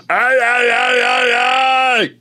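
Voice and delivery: loud high Muppet yell; Animal yell. Retold or imitated. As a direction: shout it like the wild Muppet drummer. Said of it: "He goes".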